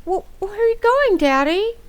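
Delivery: startled and stammering; upset, startled question; shocked child. Acted. Child learns father leaving.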